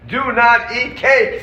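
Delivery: high, slow and firm, each word laid down; slow emphatic pronouncement: Do not eat cake; stern quoted ruling. Retold or imitated. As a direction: hand down the rule like a rabbi warning his flock. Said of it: "says the Rav Moshe".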